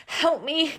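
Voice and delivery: high, loud gasp for help. A narrator's reading. Gus stuck in the hole calls to Mouse, high gasping plea. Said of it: "gasped Gus".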